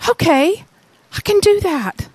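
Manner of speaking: high, lightly voiced dad; dawning relief, easy; relieved realization. Father realizing he can believe, relieved and high. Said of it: "The guy realizes".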